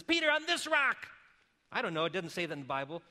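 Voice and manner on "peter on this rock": high, loud, fervent call; fired up Jesus. Retold or imitated. Jesus fired up at Peter, ringing high call. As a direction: fire it up like Jesus might have. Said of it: "he may have got really fired up".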